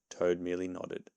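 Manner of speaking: ordinary delivery, nothing marked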